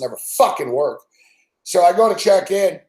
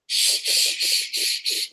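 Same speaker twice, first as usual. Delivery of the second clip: string of voiceless "shh" sounds; imitated shushing; 7 dB quieter, slower than usual. Agent with long nails silencing him, imitated shushing. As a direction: hush them over and over, wordlessly. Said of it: "She was like".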